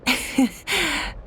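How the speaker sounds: soft, high little laugh; light giggle ending in a breath; amused chuckle. Acted. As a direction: laugh gently at a friend. Amused at overfed Paimon.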